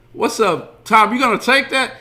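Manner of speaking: high, loud wiseguy taunt; loud needling. Retold or imitated. Jimmy goading Tommy at the table, loud taunt. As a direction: egg him on, loud and high.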